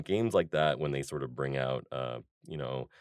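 ordinary delivery

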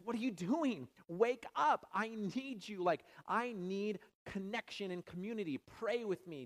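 loud, high pleading to sleeping friends; Jesus begging his disciples to stay awake, loud anguished plea; urgent plea. Retold or imitated. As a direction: call out desperately to wake them. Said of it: "He's like".

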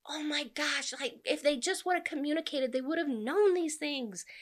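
high, exclaiming frustration at the characters; exasperated reader. Acted. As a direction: throw up an exasperated reader's outcry. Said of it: "this whole time you're like".

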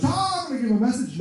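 high-pitched cry of someone seized; sudden high "ah" as if taken over; mock possessed. Preacher mimics worried people imagining tongues, mock possessed. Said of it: "Spirit's gonna possess me and take over".

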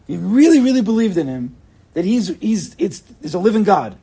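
loud, high, fervent plea; impassioned exhortation. Real, not acted. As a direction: preach it with full force. Impassioned plea about belief.

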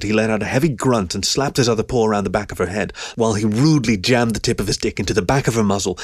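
usual speaking voice

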